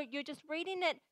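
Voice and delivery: ordinary talk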